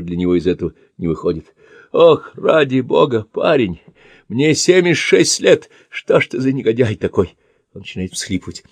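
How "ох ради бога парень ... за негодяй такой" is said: tearful pleading. Acted. Old man pleading about age.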